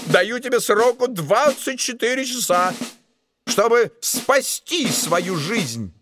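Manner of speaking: high, swelling angry threat; furious oath. A narrator's reading. Duke threatening the dwarf with beheading, high furious oath. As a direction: swear it and bark the dwarf out.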